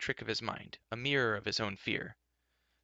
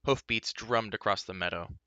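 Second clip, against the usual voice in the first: plain reading voice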